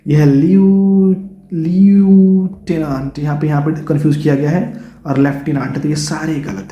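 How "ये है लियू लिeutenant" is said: लिeutenant stretched very slowly, li-u-te-nant; spell-read लिeutenant syllable by syllable; drawn-out li-u-te-nant; spelling sounded out. Shown as a demonstration. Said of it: "लेकिन ये गलत है".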